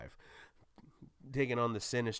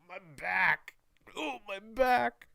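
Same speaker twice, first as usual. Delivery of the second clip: much higher, louder, strained cry of back pain, stretched slow; hammed-up Tobey pain cry; 11 semitones higher, 5 dB louder, slower than usual. Spider-Man reliving his famous fall, hammed-up pained wail. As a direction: ham up the old movie's pained yelp. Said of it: "was like".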